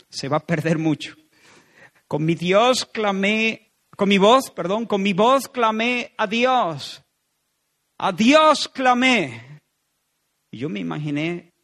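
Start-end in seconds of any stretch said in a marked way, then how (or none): 2.1-9.6 slow, loud, lifted cry of the psalm; loud cry to God. Shown as a demonstration. Preacher crying the psalm aloud to God, slow and loud. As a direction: call it out slowly with full voice. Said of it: "estos versos sonando de esta manera".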